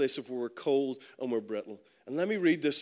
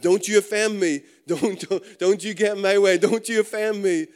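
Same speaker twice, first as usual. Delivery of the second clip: raised, loud indignant warning; mock offended; 4 semitones higher, 7 dB louder than usual. Preacher acting out someone easily offended, loud mock indignation. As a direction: play it touchy, hammering each don't.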